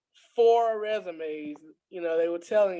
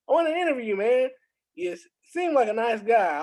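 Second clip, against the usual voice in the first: eager recruiter; 6 semitones higher, faster than usual. Retold or imitated. Recruiter voiced in a story.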